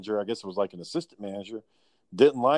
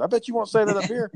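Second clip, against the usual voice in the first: higher, laid-back Californian dude voice; Californian guy; 11 semitones higher than usual. Retold or imitated. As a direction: play Jimmy as a Californian telling him off. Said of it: "so he sounds like a Californian guy".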